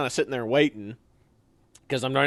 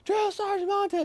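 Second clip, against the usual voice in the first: breathless shout; 3 dB quieter than usual. Retold or imitated. Trainee sprinting up to the drill sergeant, breathless high shout. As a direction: yell for the sergeant while gasping.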